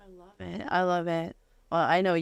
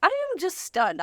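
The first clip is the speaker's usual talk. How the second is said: high, slow amazement; stunned; 11 semitones higher, slower than usual. Real, not acted. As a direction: let the disbelief stretch out. Host amazed by guests flying in.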